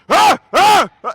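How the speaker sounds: high, loud yappy dog noises; barking dog imitation. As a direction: bark it out like an excited little dog. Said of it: "dogs that going".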